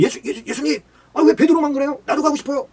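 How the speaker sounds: high, loud, whiny begging call; eager pleading cry. Pretend. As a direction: beg like a jealous disciple. Disciples begging to walk too. (높고 크게 조르듯 "나도 가고 싶어요!")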